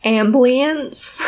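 ambulance squeezed into three syllables, amblience; dad's amblience. Retold or imitated. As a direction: say amblience like her dad from Beaver, Utah. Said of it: "says the word ambulance like amblience".

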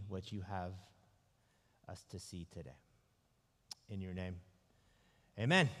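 hushed, earnest morning prayer. Acted. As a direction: pray quietly over the congregation. Pastor opens with a prayer.

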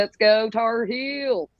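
very slow North Carolina twang; stretched-out North Carolina cheer; North Carolina accent. Shown as a demonstration. Co-host demonstrating her version, drawn-out North Carolina accent. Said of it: "I can do North Carolina".

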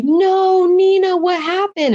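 high, drawn-out retold cry of disbelief; imitating upset mom. Mom hearing her daughter is out, high imitated wail.

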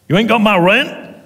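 high, loud outraged landlord; angry landlord. Acted. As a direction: shout it in disbelief. Landlord outraged at missing rent.

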